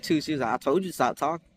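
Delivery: normal talking voice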